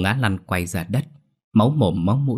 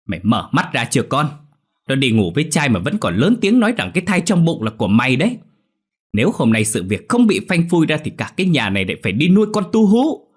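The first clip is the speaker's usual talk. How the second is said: louder, rising to a shriek at the end; scornful scolding; 6 semitones higher, 5 dB louder than usual. Acted. Mother scolding her son Long over the cheating wife, scornful yelling. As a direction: scold the son for being fooled. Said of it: "chỉ vào mặt Long mà chửi".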